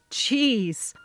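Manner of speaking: usual speaking voice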